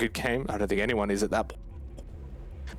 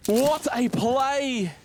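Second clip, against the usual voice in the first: high, slow, stretched caster calls; hyped play-by-play; 9 semitones higher, slower than usual. Real, not acted. Caster calling the map win for Elevate, hyped play-by-play. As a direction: draw out every call of the win.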